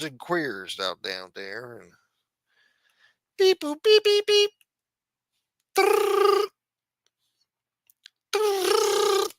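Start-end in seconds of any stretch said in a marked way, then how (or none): 3.4-4.5 very high mouth-made phone beeps; vocal dialing beeps. Acted. Streamer dialing a pretend phone call, high vocal beeps. 5.8-9.3 very high rolled trill ringing tone; vocal phone ring. Acted. Streamer's pretend call ringing out, high trilled ring.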